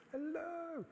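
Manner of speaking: very high, soft, drawn-out hello; tiny high-pitched muffled call; tiny little voice. Retold or imitated. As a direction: squeak it faintly as if from far away. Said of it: "I heard this tiny little voice".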